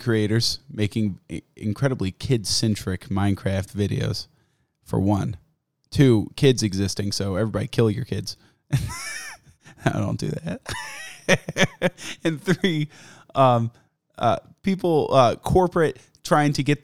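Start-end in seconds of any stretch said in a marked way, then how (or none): none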